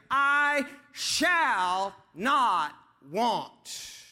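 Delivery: drawn-out, forceful SHALL; very slow vow with SHALL held long; stress on SHALL. Shown as a demonstration. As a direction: declare it word by word, leaning hard on "shall". Repeats the demonstrated emphasis on shall.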